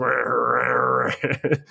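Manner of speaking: long imitated tummy rumble; drawn-out mock growl; stomach growl. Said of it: "my stomach goes".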